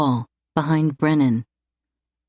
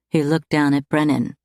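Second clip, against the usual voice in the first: usual speaking voice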